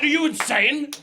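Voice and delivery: heated comic indignation; incredulous outburst. Retold or imitated. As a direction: challenge him in disbelief. Heated retold argument over place names.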